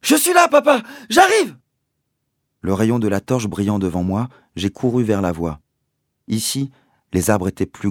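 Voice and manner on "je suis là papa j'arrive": high, loud reply; boy answering his father as he runs, shouting; calling out. Acted. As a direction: shout back while running. Son answering while running.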